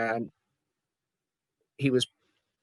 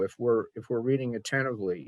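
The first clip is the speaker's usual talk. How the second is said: ordinary delivery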